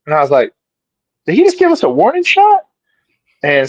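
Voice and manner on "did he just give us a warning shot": high-pitched incredulous question; retold disbelief. Man retelling his reaction to gunfire, incredulous. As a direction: squeak it out, replaying your own astonishment.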